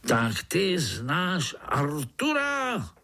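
slow, high-pitched roar. A narrator's reading. Ant overseer confronts spider about Artur, roaring. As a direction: roar it slowly as an accusation. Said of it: "zařval".

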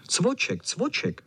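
high, quick dog calls; barking. Acted. All the dogs bark for Cvoček to tell a story. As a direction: yap the name up high. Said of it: "štěkali všichni".

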